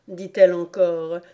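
plain reading voice

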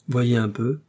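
plain reading voice

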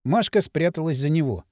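ordinary delivery, nothing marked

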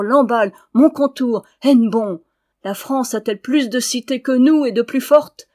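building from firm to loud ringing roll call of cities; rousing battle call. Acted. Appel guerrier qui monte. (appel guerrier de plus en plus fort)

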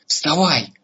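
sharp wake-up call. Acted. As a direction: order him up. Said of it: "снова окликнула его". (резкий окрик)

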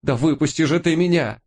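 high, panicked cry to get out; terrified plea. A narrator's reading. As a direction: beg in sheer panic. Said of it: "От ужаса".

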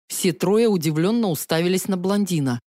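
ordinary delivery, nothing marked

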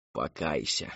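low, soft coaxing; gently urging. A narrator's reading. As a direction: urge her softly, dropping the pitch. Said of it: "мягко поторопила миссис Рэдклифф".

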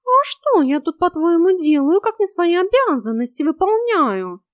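high, loud cat voice; high-pitched, loud self-defence; indignant cat. A narrator's reading. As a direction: protest as a wronged cat.

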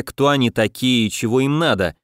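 usual speaking voice

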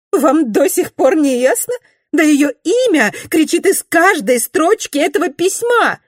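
high, excited gossiping lady; a high-pitched, eager young society lady. A narrator's reading. Narrator voices Mrs Glenarm.

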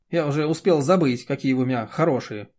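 ordinary delivery

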